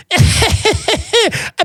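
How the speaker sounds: high-pitched imitated laugh; waiter's laugh. Waiter laughing at the diner's joke, high imitated laugh. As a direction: cackle high as the amused waiter. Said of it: "he started laughing".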